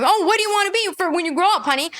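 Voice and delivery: high, fast singsong parent voice; playful send-up of a doting parent; mock sweet parent. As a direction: chirp it like a cooing parent. Mocking a parent asking child.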